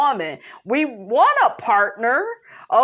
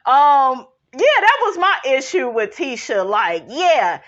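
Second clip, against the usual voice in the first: ordinary delivery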